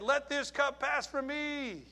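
high, slow plea to be spared; preacher voicing Jesus' earnest plea; pleading prayer. Jesus' plea before suffering, quoted.

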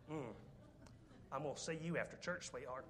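a quiet sugary threat; threatening wife. Retold or imitated. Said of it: "Women are looking at me like".

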